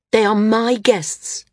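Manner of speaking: measured, understated fury at the insult; furious. A narrator's reading. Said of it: "Maris said furiously".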